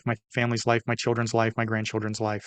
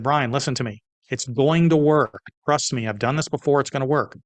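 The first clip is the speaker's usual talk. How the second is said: insistent reassurance; 3 semitones higher, 4 dB louder than usual. Retold or imitated. Mentor tells worried partner to trust him, firmly insistent. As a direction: drive it home, as a retold mentor.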